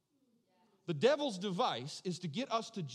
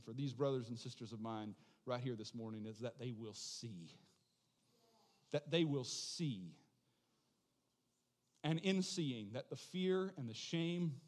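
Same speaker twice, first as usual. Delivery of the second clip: closing prayer; 3 semitones lower than usual. Acted. Pastor praying over brothers and sisters, hushed.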